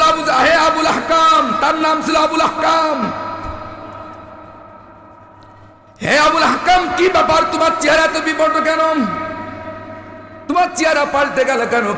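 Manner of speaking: high, urgent sermon questioning; preaching at full force. Acted. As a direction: ask it high and alarmed. Crowd voiced asking Abu Jahl.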